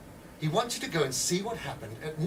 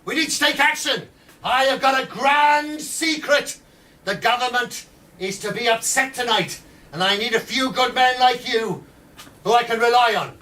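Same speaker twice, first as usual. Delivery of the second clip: much higher and louder, a pub-room firebrand call; rabble-rousing agitator; 7 semitones higher, 8 dB louder than usual. Retold or imitated. Edwards recruiting men in pubs, loud, high and rousing. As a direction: whip up the drinkers with a loud, high call to revolt. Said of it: "he would go around saying".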